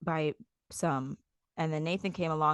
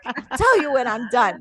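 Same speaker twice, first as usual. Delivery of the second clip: loud, higher-pitched comeback; mock angry retort; 5 semitones higher, 6 dB louder than usual. Wife telling husband she isn't done arguing, mock angry. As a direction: fire it back like you're still fighting. Acted quarrel, refusing to stop.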